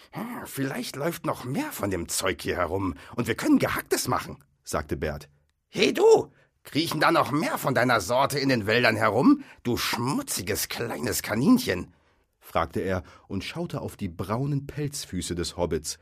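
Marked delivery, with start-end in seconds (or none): 0.1-4.4 higher-pitched troll, pondering; hint of a scheming troll; lightly voiced musing troll. A narrator's reading. Bert musing about more Gehacktes, lightly voiced troll. 5.7-11.9 higher troll voice, loud start, slowing to the insult; menacing troll interrogation. A narrator's reading. Troll interrogating Bilbo about his kind, menacing.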